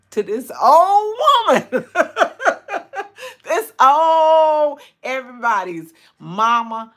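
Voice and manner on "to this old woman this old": laughing self-mockery. Real, not acted. Thanking viewers for kindness to this old woman, laughing self-mockery. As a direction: crack up while teasing yourself, pitch jumping up.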